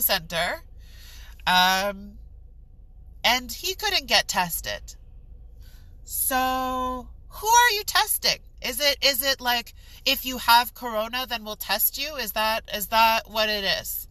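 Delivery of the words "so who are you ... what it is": exasperated, higher-pitched questioning; frustrated. Real, not acted. Turned away from COVID testing, frustrated. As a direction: push the questions out in real frustration. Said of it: "I'm frustrated".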